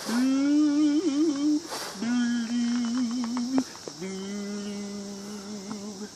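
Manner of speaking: mope it out, then let the blooms sink and fade; glum pout pout fish. Acted. Fish answers squid glumly.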